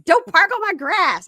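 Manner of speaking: high, loud retold scolding; hollered mock order; mock yelling. Retold girlfriend yelling at driver.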